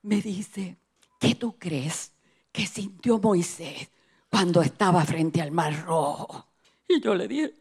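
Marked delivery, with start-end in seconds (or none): 1.2-6.5 low, hushed, slow divine question; voice of God. Retold or imitated. God questions the frightened preacher, hushed and low. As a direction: ask it near a whisper, unhurried. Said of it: "escucho la voz de Dios que me dice".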